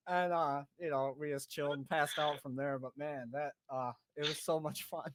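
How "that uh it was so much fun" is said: normal talking voice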